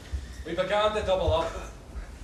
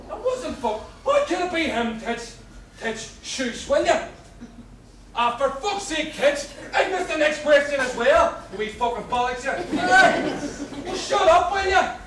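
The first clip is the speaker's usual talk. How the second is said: rising furious yell, much higher and louder; angry shouting; 9 semitones higher, 9 dB louder, slower than usual. Acted. Prisoner yelling at cellmate to shut up during quiz. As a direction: shout him down so you can hear.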